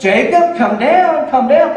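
loud, high call to come down; loud, high calling to a stuck toddler. Retold or imitated. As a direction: urge a child down from the top. Parent calling child down.

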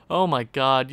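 ordinary talk

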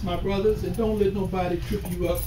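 ordinary talk

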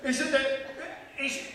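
high, loud frantic calls of Agnes; frantic shouting. Acted. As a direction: burst in calling her name in a panic. Frantic Agnes/what exchange.

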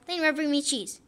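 ordinary talk

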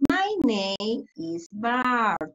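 very slow, drawn-out "My name is Bart."; slow model answer. Shown as a demonstration. Teacher modeling Bart's answer for pupils, very slow. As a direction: stretch "My name is Bart." out word by word. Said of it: "is Bart Simpson".

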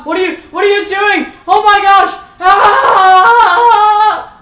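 panicked shout. Real, not acted. Shrieking while something jumps on him in the game. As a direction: shriek as if ambushed.

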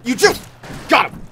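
loud high victory yell; triumphant shout. Real, not acted. Kills enemy, regains rifle.

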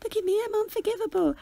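very high silly mocking voice; squeaky-high mimicked mockery; high-pitched mocking. Shown as a demonstration. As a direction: show an overdone high-pitched taunt. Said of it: "don't make your voice high pitched".

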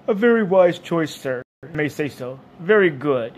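lightly voiced bovine waiter, loud at first; a hint of deferential beast; obliging dairy animal. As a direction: approve the diner's choice.

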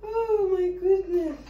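high, slow whimper; half-cry it in exaggerated despair; mock-tearful dismay. Vlogger despairs over botched baking, mock-tearful dismay.

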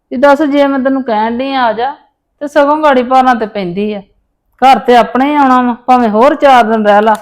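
loud scolding on the phone. Acted. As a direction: tell her off loudly. Mother scolds daughter on phone.

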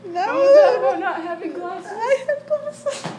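high shrieking laughter through words; cracking up, can barely speak; laughing hard. Real, not acted. Caught out about glasses.

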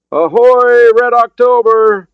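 high, drawn-out shouted hail; calling out. Acted. Sailor hailing a submarine in the darkness, calling out. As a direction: hail a ship loudly across the dark. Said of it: "a voice called in the darkness".

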